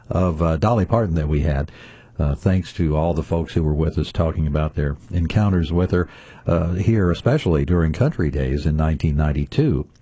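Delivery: ordinary talk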